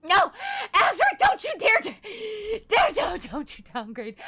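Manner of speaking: loud playful protest; mock protest. Real, not acted. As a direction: beg them not to, playfully. Mock pleading to chatter.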